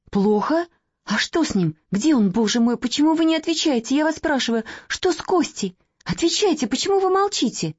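rising alarm, pressing harder with each question; a frightened young woman demanding answers; anxious questioning. Acted. Young woman frightened for Kostya, pressing the children, anxious. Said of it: "взволнованно".

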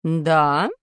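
surprised. Acted. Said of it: "удивилась Нина Васильевна".

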